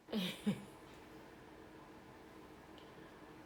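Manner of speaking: long, quiet breathy laugh; helpless laugh you are trying to hold in; suppressed laughter. Real, not acted. Storyteller remembering her friend's fall, real stifled laughter. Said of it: "It's been hard to hold stuff in".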